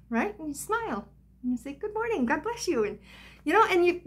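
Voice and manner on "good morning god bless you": bright, much higher fake-happy hello; put-on cheerful greeting. Hiding her pain from others behind a forced cheerful greeting. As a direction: paint on a smile and chirp it. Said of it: "You put on a happy face".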